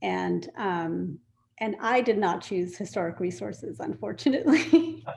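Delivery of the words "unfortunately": higher, breaking into a laugh; rueful little laugh while owning up; laughing admission. Real, not acted. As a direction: let a laugh lift the word. Rueful joke about not choosing historic resources.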